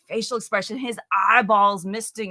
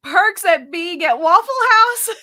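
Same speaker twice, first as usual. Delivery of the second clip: playful; 10 semitones higher, 8 dB louder, slower than usual. Real, not acted. Joking title pun.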